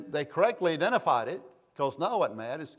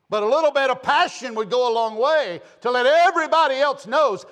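loud, high pulpit exhortation; fired-up preacher, voice raised; impassioned preaching; 7 semitones higher, 7 dB louder than usual. Real, not acted. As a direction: preach at full force, pushing the key words. Preaches at full force.

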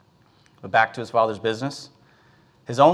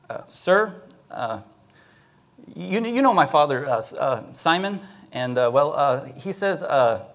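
put-on hesitant boy; 2 semitones higher than usual. Young Rufus nervously asks a fisherman for his father's debt, hesitant. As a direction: stumble through it, feeling for each word.

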